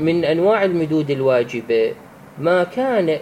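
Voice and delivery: usual speaking voice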